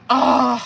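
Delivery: loud drawn-out ugh; exasperated groan. Real, not acted. Reader reacting to the current energy cards, loud groan. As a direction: groan at what turns up.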